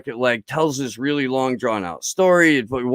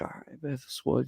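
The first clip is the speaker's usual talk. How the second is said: half-whispered warning; 15 dB quieter than usual. Retold or imitated. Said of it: "dude's like".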